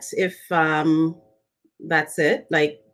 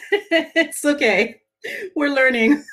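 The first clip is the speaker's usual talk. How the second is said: laughing; 7 semitones higher, slower than usual. Real, not acted. Laughing off tech glitches.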